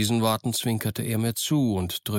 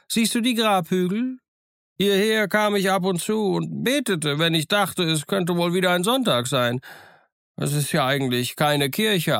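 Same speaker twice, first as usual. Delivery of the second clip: higher, lively voice of a half-wild marooned sailor; higher-pitched, eager old castaway; eager castaway Ben Gunn; 10 semitones higher than usual. Acted. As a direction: play the odd, chattering island hermit, pleased with himself. Ben Gunn chattering while running.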